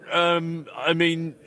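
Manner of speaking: ordinary talk, nothing marked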